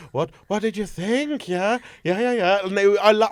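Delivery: ordinary talk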